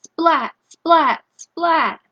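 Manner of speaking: high, loud splat sounds; punchy mock lightning noise. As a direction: smack each splat out like a lightning strike.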